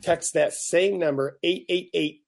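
upbeat on-air contest read; radio contest promo. Acted. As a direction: pitch the giveaway like a DJ. Reads contest call-in promo.

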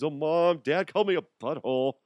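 high, fast tattletale whine; tattling kid. Retold or imitated. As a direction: tattle like a child.

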